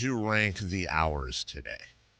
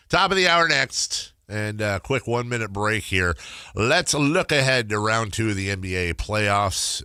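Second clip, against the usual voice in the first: radio announcer; 3 semitones higher, 7 dB louder than usual. Acted. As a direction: punch it like a sports radio host. Host announcing break, loud.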